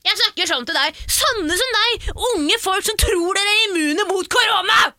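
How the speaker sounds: high, loud mock yell of a scolding woman; mimicking angry customer. Storyteller imitating the customer screaming at her, hammed up. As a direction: shout it like the furious old lady. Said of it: "Sier hun".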